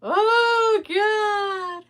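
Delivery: laugh-cry at herself; tearful laugh. Real, not acted. Remembering her tears, a high teary groan. Laughing about crying over account.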